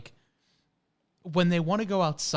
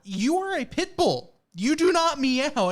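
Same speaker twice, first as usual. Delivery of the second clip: mock scolding; 7 semitones higher, 5 dB louder than usual. Owner scolding the pit bull.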